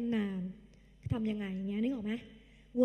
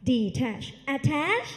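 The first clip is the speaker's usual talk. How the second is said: very slow, louder detach attach; slow drill; 4 dB louder, much slower than usual. Shown as a demonstration. Teacher drilling the pair for students to repeat, very slow. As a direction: stretch out each word for the class. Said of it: "พูดตามพี่".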